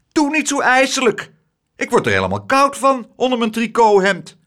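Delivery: high, loud, squeamish complaint; fussy woman's high-pitched outcry; horrified protest. A narrator's reading. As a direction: wail that it gives you chills. Woman protests at scary tale.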